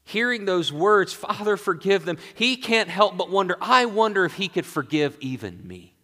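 high, fervent sermon voice, easing at the end; swept-up pulpit storytelling; impassioned preaching. Acted. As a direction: preach it urgently, then settle into humble wonder. Preacher voices thief's dawning hope.

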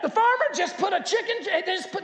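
high, loud, frantic mouse voice; panicked mouse. Retold or imitated. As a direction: rush the plea to the pig in a high panic. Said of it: "and he said".

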